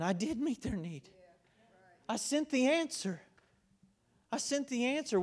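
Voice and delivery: God answering gently but pointedly; lightly voiced God. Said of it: "And God's going".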